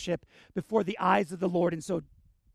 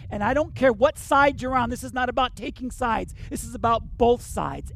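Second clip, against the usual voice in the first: preaching loudly; 4 semitones higher, 5 dB louder than usual. Real, not acted. Preaching climax, raised voice.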